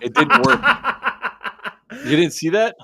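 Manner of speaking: talking through real laughter; words breaking into laughs; laughing. Real, not acted. Joking about being waterboarded.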